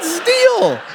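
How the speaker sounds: very high, drawn-out cry; exasperated yell. Retold or imitated. Coach repeating himself to a confused kid, exasperated yell. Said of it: "Like I said".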